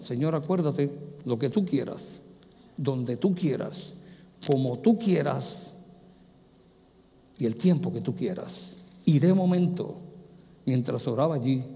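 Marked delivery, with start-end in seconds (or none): 0.0-8.8 softer, lower, slow surrendering prayer; a quiet prayer of surrender at the altar. Retold or imitated. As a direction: pray it gently and slowly, letting go.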